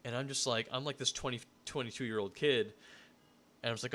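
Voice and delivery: ordinary talk, nothing marked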